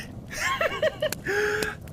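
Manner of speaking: shrill mad laugh; maniacal high cackle. Acted. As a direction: cackle like a madman. Villain laughs.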